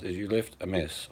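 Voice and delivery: ordinary delivery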